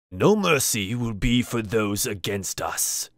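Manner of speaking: slow, lightly voiced menacing shaman; stern shaman. Shaman glares down at the kneeling party, slow mild menace. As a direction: lay down the threat slowly.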